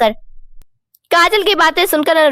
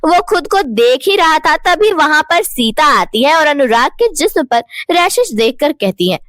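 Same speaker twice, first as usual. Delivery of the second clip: ordinary delivery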